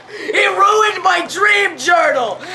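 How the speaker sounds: high, loud, drawn-out cry of complaint; outraged yell. Retold or imitated. First-grader accuses a classmate before the teacher, outraged yell. Retelling outburst over ruined journal.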